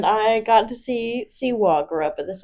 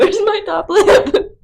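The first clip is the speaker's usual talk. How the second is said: laughing; 10 semitones higher, 5 dB louder, slower than usual. Real, not acted. Laughing with costume mustache.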